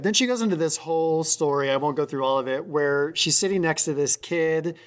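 ordinary talk, nothing marked

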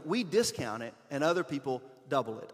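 usual speaking voice

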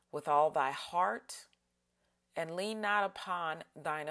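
unhurried scripture, pausing between phrases. Shown as a demonstration. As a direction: let each phrase settle before the next. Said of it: "read it slower and slower".

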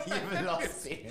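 slow, laughing through the words. Real, not acted. Father laughing at his kids' lost Swahili, slow and amused. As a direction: drag it out while laughing. Laughing at kids losing language.

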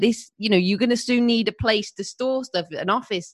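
high, fast, emphatic husband; insistent husband. Retold or imitated. Husband voiced insisting it's business.

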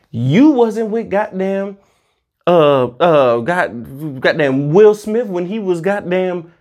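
normal talking voice

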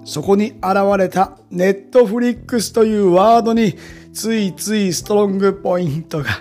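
upbeat teaser narration; punchy variety show voiceover. Acted. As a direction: sell the next episode. (バラエティ番組風のナレーション)

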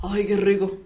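savoring a hot sip of coffee; just sipped hot coffee. Real, not acted. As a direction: mouth still warm from coffee. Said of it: "voy a darle un sorbito a mi cafecito".

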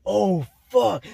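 high, loud mock-panicked outburst; dramatized cry of dread; retold panic. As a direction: blurt it out as if just caught. Retold panic at mom's call.

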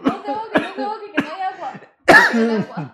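laughing. Real, not acted. Friend choking, she laughs loudly.

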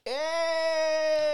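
high, loud cheer; excited shout. Real, not acted. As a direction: whoop with delight. Reacting to a menu.

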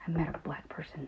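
put-on a little boy whispering his big news; hushed kid whisper. Son whispering to mom in the car. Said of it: "He goes".

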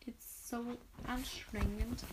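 quiet, slow, fed-up sigh of a reader; soft, slow grumble about the hard text; exasperated aside. Real, not acted. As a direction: groan it out to the listeners, worn out. Reader frustrated aside, stumbling.

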